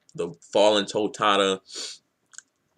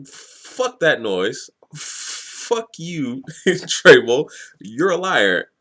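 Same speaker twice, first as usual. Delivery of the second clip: drawn-out, raised retort, imitating an angry captive; mock defiance; 6 semitones higher, 4 dB louder, much slower than usual. Captive defends his hero against the villain, mock defiant. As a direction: throw the accusation back, half in fun.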